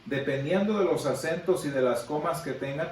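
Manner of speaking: ordinary talk, nothing marked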